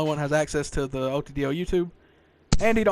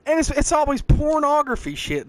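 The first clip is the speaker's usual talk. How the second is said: slow, stretched-out words, pitched up; drawn out; 9 semitones higher, 4 dB louder than usual. Real, not acted. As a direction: stretch out pornography shit lazily. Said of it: "that prolonged like that".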